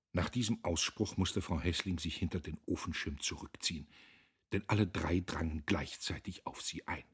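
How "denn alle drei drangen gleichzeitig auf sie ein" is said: ordinary delivery, nothing marked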